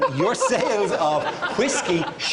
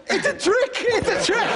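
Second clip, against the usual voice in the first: high squeaky mock panic; released prisoner panicking at the flags; panicked. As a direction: yelp it like a freed prisoner spooked. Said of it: "Would you not just go".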